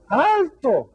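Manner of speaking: high shouted stop; a boy calling out sharply; boy's high shout. A narrator's reading. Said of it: "gritó el niño".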